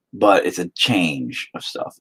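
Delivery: ordinary delivery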